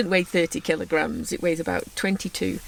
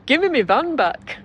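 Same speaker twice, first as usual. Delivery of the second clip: laughing; 6 semitones higher, 6 dB louder than usual. Real, not acted. Laughing exasperated plea.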